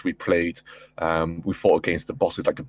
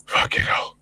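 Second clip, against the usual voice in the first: breathy sigh of relief, barely voiced; acted-out exhausted relief; deep relieved breath; 4 semitones higher than usual. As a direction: exhale deeply, then whisper it out. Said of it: "You just breathe this deep".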